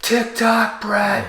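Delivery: high-pitched reenacted fan yell; mock stadium holler, high but not full volume; retold yelling. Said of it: "we're just yelling".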